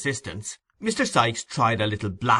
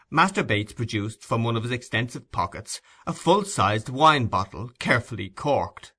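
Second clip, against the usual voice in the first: ordinary delivery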